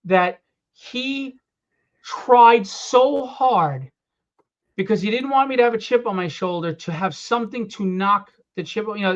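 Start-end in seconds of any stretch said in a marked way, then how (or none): 2.0-3.9 "tried so hard" stretched very slowly, each word leaned on; drawn-out emphasis. Real, not acted. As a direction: draw out "tried so hard" to show the effort. Stressing father's effort.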